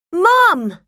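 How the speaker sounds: high, loud excited call to Mum; boy calling his mum excitedly. A narrator's reading. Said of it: "Tim exclaimed".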